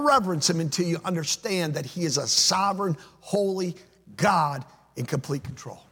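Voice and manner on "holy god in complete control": usual speaking voice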